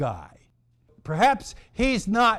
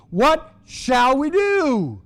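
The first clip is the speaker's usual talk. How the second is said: high, drawn-out acted plea; great distress; 7 semitones higher, 4 dB louder, slower than usual. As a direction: wail it in mock helplessness. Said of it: "He is in great distress".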